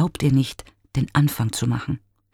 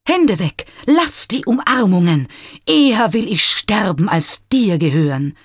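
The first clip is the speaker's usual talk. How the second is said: high, loud rejection while fleeing; angry shout; 7 semitones higher, 4 dB louder than usual. A narrator's reading. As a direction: push her away with a shout. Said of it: "ruft er".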